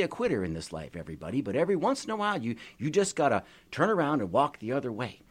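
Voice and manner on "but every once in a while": usual speaking voice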